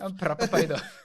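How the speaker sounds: put-on British lad accent through laughter; Love Island accent, cracking up; laughing British accent. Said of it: "the Love Island one?".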